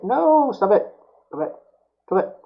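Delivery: high, loud cry at the wire; frustrated yell. Real, not acted. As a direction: yell at it to quit. Wire twisting, yelling at it.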